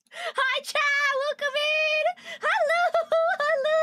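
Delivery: high, bright streamer hello to chat; excited greeting. Real, not acted. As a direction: call out to the chat with joy.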